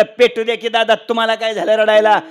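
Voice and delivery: loudly dismissive acted retort; loud brush-off. Middle son answers crying father, loud and dismissive. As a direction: shrug off dad's wailing at full volume.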